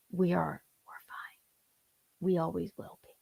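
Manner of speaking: soft, trailing almost to a whisper; quiet reassurance. Real, not acted. Wife assuring listeners the couple is okay, hushed. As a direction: reassure them very softly. Reassures viewers after the fight.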